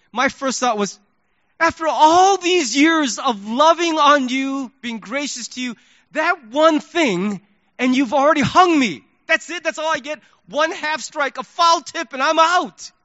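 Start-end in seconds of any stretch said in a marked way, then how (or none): 1.6-12.9 higher, louder wounded outburst, starting drawn out; indignant protest. Retold or imitated. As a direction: act out feeling unfairly condemned. Acted indignant inner protest.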